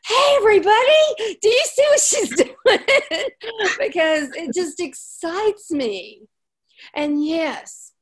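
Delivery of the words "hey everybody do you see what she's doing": high, loud, laughing call to the room; bubbling over with delight; excited call-out. Retold or imitated. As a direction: call out to everyone, thrilled. Excitedly calling out to group.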